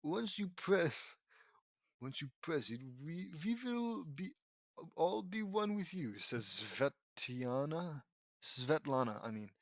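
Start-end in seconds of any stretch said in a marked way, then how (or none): 0.0-6.2 put-on tentative, halting Russian accent; roughly put-on Russian accent. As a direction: attempt a light Russian accent, feeling for it. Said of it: "Russian accent?".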